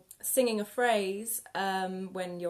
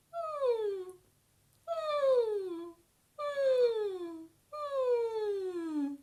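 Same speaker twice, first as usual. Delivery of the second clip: high gentle whimpering mm sliding down; crying puppy noises; 13 semitones higher, much slower than usual. Shown as a demonstration. Teacher models crying puppy hums for the warm-up, gentle high whimper. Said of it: "imagine we're a crying puppy".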